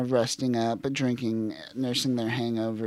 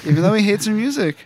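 mock excited echo; 8 semitones higher, 11 dB louder, faster than usual. Repeating co-host's joke.

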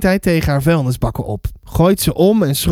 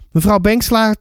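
ordinary delivery

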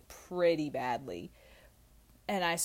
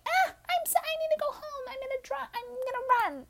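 put-on much higher, panicky drunk squeal; frantic high voice. Correcting the impression of her drunk self, high frantic squeal. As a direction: squeal it in a frantic, teary rush. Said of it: "No, I was more like".

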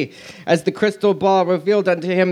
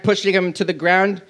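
ordinary delivery

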